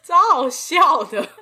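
high laugh, then words laughed out. Real, not acted. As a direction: crack up while saying it. Laughing at funny story.

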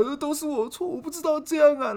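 high, teary mock sobbing apology; imitating mom crying. Son imitating his crying mother apologising, high mock sob. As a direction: play the weepy mother, half-hearted. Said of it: "她直接就哭了".

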